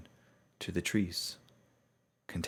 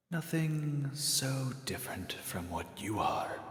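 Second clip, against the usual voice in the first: mysterious hooded stranger; 7 semitones higher, slower than usual. Acted. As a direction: hush it like a figure in the dark. Stranger answers.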